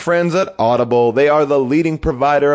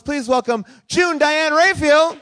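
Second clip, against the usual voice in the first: host announcement shout; 11 semitones higher, slower than usual. Pretend. Introducing co-hosts on stage.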